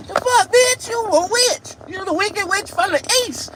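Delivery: very high, loud squealing insult; high mock shriek. Mocking women with pointy nails, high shrieking. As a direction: shriek it like you're scared of a witch.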